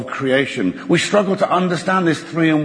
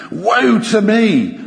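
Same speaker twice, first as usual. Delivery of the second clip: Isaiah's cry; 8 semitones higher, 4 dB louder, much slower than usual. Acted. Reads Isaiah's cry louder, slow.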